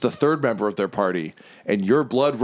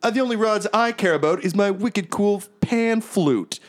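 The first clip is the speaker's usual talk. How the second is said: vain bard; 12 semitones higher than usual. Acted. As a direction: brag about your pan flute. Bravestar boasts about flute.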